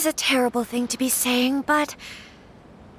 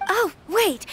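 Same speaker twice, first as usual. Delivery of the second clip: pitched up, quick call to stop them; 6 semitones higher than usual. Acted. Hostess calls after departing guests, eager to stop them. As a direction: catch them before they leave.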